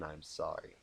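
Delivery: plain reading voice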